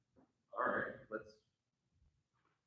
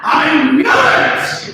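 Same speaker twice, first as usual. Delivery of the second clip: a louder, higher outburst; bursting into the party, louder startled cry; startled exclaim; 6 semitones higher, 6 dB louder than usual. Acted. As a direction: blurt it out in shock.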